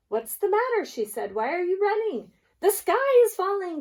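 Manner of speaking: a flock in a panic; alarmed birds. Acted. Goose asks, birds cry alarm.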